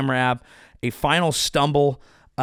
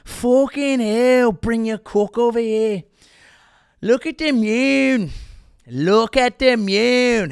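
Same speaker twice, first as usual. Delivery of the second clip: put-on slow, much higher Irish accent, louder; Conor McGregor impression; 7 semitones higher, 4 dB louder, slower than usual. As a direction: ham up McGregor marvelling at the moon in a thick Irish accent. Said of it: "I'm just Conor McGregor".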